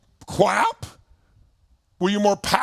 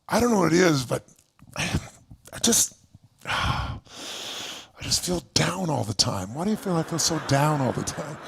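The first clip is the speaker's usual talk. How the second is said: low, soft, slow sighing whine; mopey complaint; 5 semitones lower, 4 dB quieter, slower than usual. Retold or imitated. A person stopping the coach on the street to complain, low sighing mope. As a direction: sound like someone who feels down all the time. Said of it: "They'll go".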